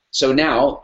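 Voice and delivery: ordinary delivery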